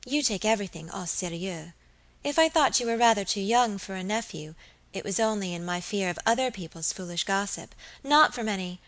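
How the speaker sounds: usual speaking voice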